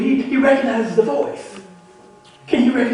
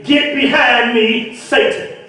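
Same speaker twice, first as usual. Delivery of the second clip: slow, deliberate words with a hushed Satan; slow rebuke to yourself; slower than usual. Shown as a demonstration. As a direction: say it slowly into the mirror, dropping to a breath on Satan. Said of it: "go look in the mirror and say".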